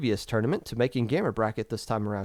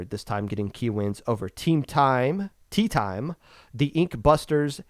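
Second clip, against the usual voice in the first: ordinary talk, nothing marked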